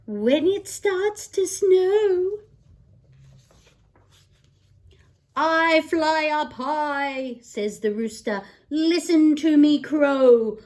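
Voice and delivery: lively picture-book read-aloud; singsong bedtime book voice; reading to children. Acted. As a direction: read it like storytime for a little one.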